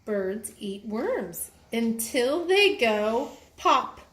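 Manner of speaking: slow, deliberate storybook line; slow read-aloud. Shown as a demonstration. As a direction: read it slowly so the child follows. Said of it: "reading the book line slowly".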